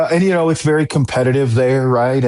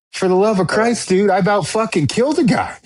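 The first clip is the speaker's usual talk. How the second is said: much higher, exasperated retold cry; exasperated outburst; 9 semitones higher than usual. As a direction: throw it up high in disbelief, like you nearly did something awful. Retelling panicked reply to buddy.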